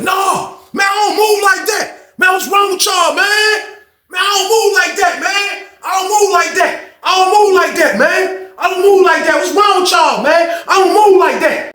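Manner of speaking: high, shouting protest, slowed and pleading; imitated frantic yell. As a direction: yell it like you're being dragged in. Inmate yelling he won't fight.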